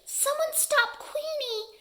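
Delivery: high, small plea for help; too-quiet cry. A narrator's reading. Quail begs anyone to stop the dog, weak high call. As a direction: call for help without real volume. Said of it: "Quincy's voice is too quiet".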